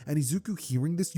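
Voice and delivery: plain reading voice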